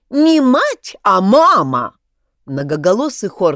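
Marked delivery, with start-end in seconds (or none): 0.1-2.0 echoing chorus. A narrator's reading. Voices echoing in Egorov's head.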